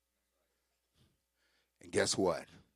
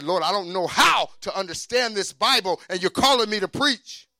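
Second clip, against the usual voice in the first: raised, pleading cry to God; loud pleading; 8 semitones higher, 10 dB louder than usual. Retold or imitated. Preacher retelling his plea to the Lord, loud and pleading. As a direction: call out in loud disbelief.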